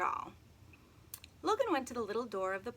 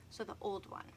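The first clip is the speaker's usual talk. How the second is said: usual speaking voice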